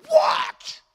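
very high, strained mock-outraged woman; hammed-up hysterical squawk, slowing into drawn-out indignation; woman losing her mind. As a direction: shriek like an offended customer, stretching the words out. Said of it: "She just lost her mind".

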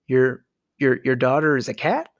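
ordinary talk

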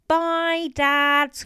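high, loud drawn-out farewell; calling out. A narrator's reading. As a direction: call goodbye to a deaf father from the door. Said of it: "called Marion as they left".

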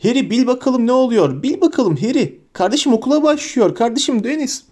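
put-on eager young fan, voice pitched high; high, excited schoolboy chatter; breathless excitement. A narrator's reading. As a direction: burst with news in a high voice. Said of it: "heyecandan soluk soluğa bir ses".

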